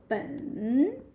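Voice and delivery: 本 with a dip and a rise; third tone. Shown as a demonstration. Teacher isolating the measure word, 本 in third tone. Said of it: "this is being third tone as well".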